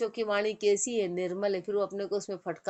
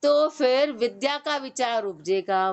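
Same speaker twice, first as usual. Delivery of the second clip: ordinary delivery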